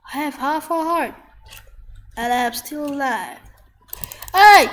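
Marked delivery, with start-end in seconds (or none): 4.3-4.7 loud, high yell; one big high-pitched holler; sudden shout. Real, not acted. Sudden shout during the game.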